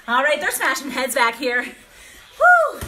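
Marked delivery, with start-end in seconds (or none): 2.4-2.8 a loud whoop, pitched high; cheering woo. Real, not acted. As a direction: whoop to fire up the class. Cheering on the class.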